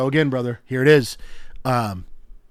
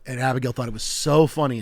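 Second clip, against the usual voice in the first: normal talking voice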